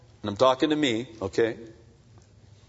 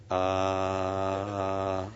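gentle heavenly father; 3 semitones lower, slower than usual. Retold or imitated. Heavenly Father gently reminding his son, lightly voiced. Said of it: "I hear this voice saying".

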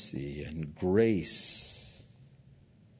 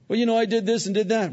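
fast, high mock confession; sheepish believer; 12 semitones higher, 5 dB louder, much faster than usual. Believer recounting sins to God, fast and high. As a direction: rattle off excuses.

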